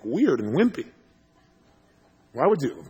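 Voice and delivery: normal talking voice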